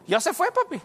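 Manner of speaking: high, bright relieved daughter's line; lightly voiced happy girl.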